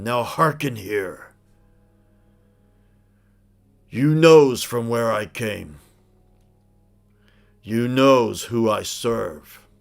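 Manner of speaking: slow, loud solemn troll chief rallying his soldiers; Mormog the troll speech. Acted. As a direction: build it up like a war speech to the clans. Troll chief addresses gathering.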